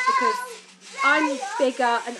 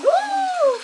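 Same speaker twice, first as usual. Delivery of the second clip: much higher, louder delighted "Ooh!"; excited squeal; 12 dB louder than usual. Retold or imitated. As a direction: squeal like a thrilled fan. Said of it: "I was like".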